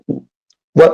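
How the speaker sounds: ordinary delivery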